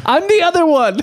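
high mock plea; mistaken-twin protest. Innocent twin to angry drivers, high mock protest.

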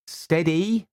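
high steadying call; steady her. A narrator's reading.

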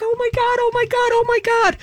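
high squealing oh my God, over and over; hammed-up girlfriend hearing a proposal is coming; imitating excited girlfriend. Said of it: "She's like".

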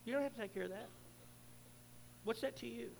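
a quiet voice heard inside the head; lightly voiced, hushed inner God; God's voice in my head. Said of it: "reminding me".

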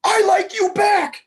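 very high, loud, slow squeal; excited squeal. A narrator's reading. Girl answering his confession, squealing with joy. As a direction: squeal it with pure delight. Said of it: "Samantha squealed".

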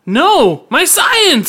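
loud high anguished cry; screaming. Acted. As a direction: scream for your lost work. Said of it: "screams Labet".

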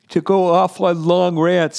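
words swallowed by a yawn. Real, not acted. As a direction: stretch the words out in a real yawn. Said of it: "I'm yawning here".

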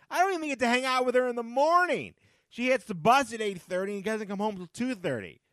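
high, loud, hammed-up lament; mock distressed outburst. Mock distress over lost mornings.

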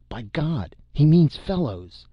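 excited outburst of realization; sudden realization. A narrator's reading. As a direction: flare up with sudden understanding. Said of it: "Then suddenly he flamed".

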